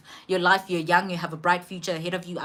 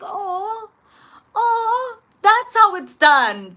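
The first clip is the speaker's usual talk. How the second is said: much higher, hammed-up mock awe, slow at the end; mock impressed; 13 semitones higher, 7 dB louder, much slower than usual. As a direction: play it up like a stunned fan. Mocking the nurse's confidence.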